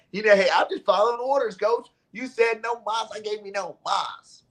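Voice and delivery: 调皮地回答教练; mock player reply.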